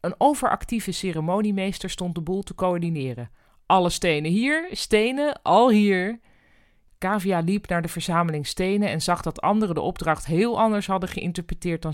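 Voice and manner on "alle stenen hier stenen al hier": loud, drawn-out call to the guests. Acted. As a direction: summon everyone slowly and loudly. Overactive master of ceremonies calling.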